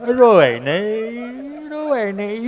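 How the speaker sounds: choked-up doctor. Acted. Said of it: "doctor સાહેબ ગળગળા થઈ ગયા".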